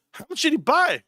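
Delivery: high-pitched astonished question; mock shocked asking; imitating startled wife. Voices his wife's startled question.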